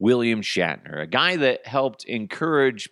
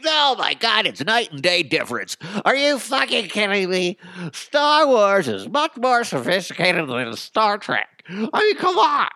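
higher, louder, outraged fan whine; mock a huffy Star Wars nerd; higher, louder indignant geek rant; 10 semitones higher, 4 dB louder than usual. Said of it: "Don't get all nerdy on me".

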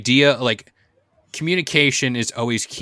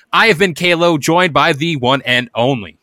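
host sign-off; 5 semitones higher, 5 dB louder than usual. Acted.

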